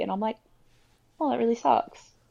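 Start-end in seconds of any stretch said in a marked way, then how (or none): 1.2-2.2 higher, louder sarcastic mock sorry; high mock-apologetic voice; mock apology. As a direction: play up the fake sympathy. Mocking her own reactions.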